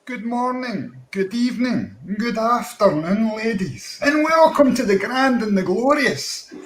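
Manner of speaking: booming grand host welcome; showman greeting. Acted. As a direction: announce it like a ringmaster.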